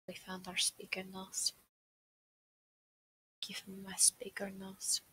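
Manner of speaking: soft private whisper; hushed demand for the notes; whispered aside. Real, not acted. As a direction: whisper it off to the side. Aside while fetching notes.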